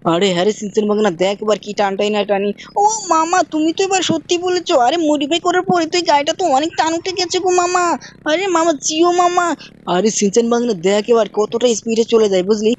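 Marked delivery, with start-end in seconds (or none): 2.8-9.5 put-on high, pleading little-boy voice; Shinchan child voice. Shinchan nagging his uncle, high childish voice. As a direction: pitch way up and whine at mama like a small kid.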